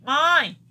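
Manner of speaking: "Mãe" loud, sent through the nose; nasal sound. Shown as a demonstration. Teacher demonstrating nasal sounds, exaggerated nasal vowel. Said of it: "perceberam que saiu pelo nariz?".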